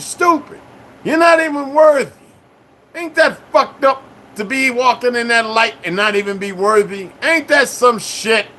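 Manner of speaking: mocking shout at the chat; high mocking rant. Real, not acted. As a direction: ride high and loud, scornful. Mocking unworthy viewers.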